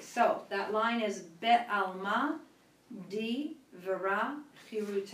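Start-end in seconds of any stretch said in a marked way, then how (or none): 3.1-5.1 slow and deliberate, each word shaped; slow model reading. Shown as a demonstration. Teacher models a phrase slowly for the class. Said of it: "go word by word".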